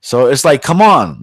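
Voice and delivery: normal talking voice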